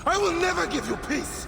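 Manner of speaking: high, loud furious cry; raging vow. Acted. Grieving father vowing endless vengeance in rage. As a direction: spit the vow out.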